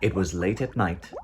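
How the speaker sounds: ordinary delivery, nothing marked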